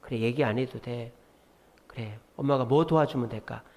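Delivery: hushed caring mom voice; tender mother. Retold or imitated. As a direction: soothe a hurting child softly. Mother comforting a struggling child.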